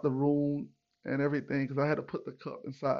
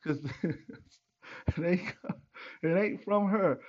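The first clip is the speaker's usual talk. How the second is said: slow, laughing through the words; 5 semitones higher, much slower than usual. Real, not acted. Teasing homeboy about his babies, laughing. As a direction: crack up at your own punchline.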